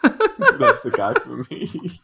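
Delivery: high, slow laughing swoon; mock swoon. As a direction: swoon over him, laughing. Joking swoon over Koons.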